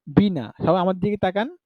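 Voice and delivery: normal talking voice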